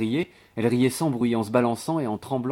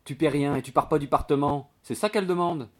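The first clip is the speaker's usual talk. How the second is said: mildly exasperated, higher voice; lightly acted scolding maid; blunt telling-off; 6 semitones higher, 4 dB louder than usual. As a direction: spell it out as if obvious. Maid spells out landlady's complaint.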